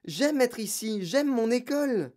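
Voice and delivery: put-on high, upset child's objection; girl pleading urgently; pleading protest. A narrator's reading. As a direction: protest that you want to stay. Girl protesting against leaving.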